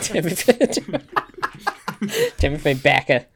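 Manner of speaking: laughing Boston accent on the name; hammy Boston accent through laughter. Shown as a demonstration. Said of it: "like saying it with a Boston accent".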